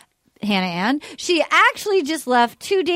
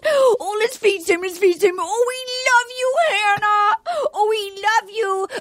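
high, tearful mock excitement; hammed-up gushing mom; 7 semitones higher than usual. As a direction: squeal and sob with overdone love. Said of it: "and she goes".

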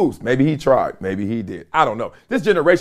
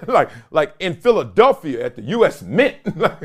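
usual speaking voice

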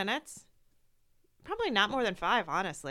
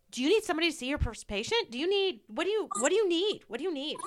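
higher and fast, flustered questions; panicky coworkers; 7 semitones higher, faster than usual. Retold or imitated. Imitating coworkers who panic over her tears, hurried and high. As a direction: rattle them off like a nervous coworker. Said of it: "like, panicky".

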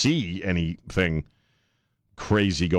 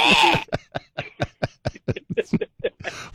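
laugh; 14 semitones higher than usual. Real, not acted. Laughs at Trump joke.

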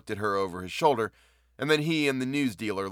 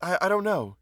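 higher, faltering surprised reply; 9 semitones higher than usual. A narrator's reading. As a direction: caught off guard and stammering. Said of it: "she said, surprised".

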